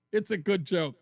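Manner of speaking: ordinary talk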